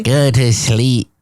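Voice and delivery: slow spooky horror-host voice; Vincent Price voice. Pretend. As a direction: drawl it like an old horror narrator. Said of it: "Is that what he sounds like?".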